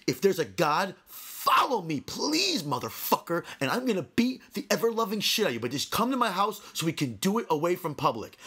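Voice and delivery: re-enacted raging threat, pitch climbing; high, insistent road-rage challenge; restrained retold scream. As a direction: rant at a rival without fully yelling. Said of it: "I'm screaming".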